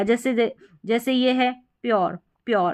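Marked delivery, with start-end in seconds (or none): none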